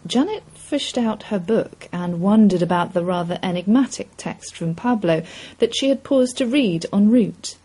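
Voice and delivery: plain reading voice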